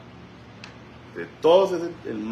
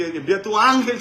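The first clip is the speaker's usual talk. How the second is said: loud cry to God; 8 semitones higher, 6 dB louder than usual. Retold or imitated. Pastor cries out to God over the woman, loud and high. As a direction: cry out to the Father with full force. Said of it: "grito yo fuerte".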